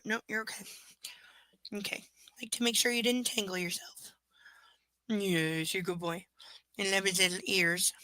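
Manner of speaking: ordinary delivery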